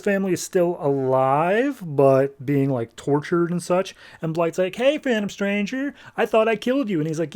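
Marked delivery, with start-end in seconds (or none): 4.7-7.0 high, sneering mock greeting; a hammed-up sarcastic villain hello; mocking taunt. Blight greeting the enemy he thought dead, a high mocking taunt.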